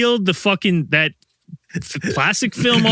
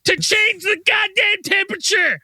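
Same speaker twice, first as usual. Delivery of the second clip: furious rant; 12 semitones higher than usual. Real, not acted. Ranting to friends about his useless new thermostat, high and shouting. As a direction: spit out every goddamn. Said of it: "I'm so fucking mad".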